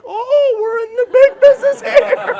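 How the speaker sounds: high, loud whooping celebration; hammed-up thrill, voice shooting way up; over-the-top excitement. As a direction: crow it like you've hit the jackpot. Mock excitement at first sale.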